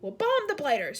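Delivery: loud blustering officer shout. A narrator's reading. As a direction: bark it like an air marshal. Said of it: "shouted the head of the Air Force".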